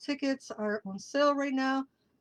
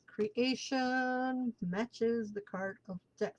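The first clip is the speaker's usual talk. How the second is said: slow, softer dramatic reveal; slow and hushed; 4 dB quieter, slower than usual. Shown as a demonstration. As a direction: let each word land slowly.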